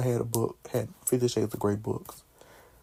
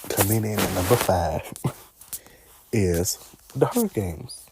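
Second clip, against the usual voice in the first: countdown announcer; 3 dB louder than usual. Acted. Announcing her top list, announcer style. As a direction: announce number five like a chart show.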